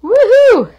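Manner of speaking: high, loud happy whoop; excited cheer. Real, not acted. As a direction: cheer it out with real delight. Cheering at finished design.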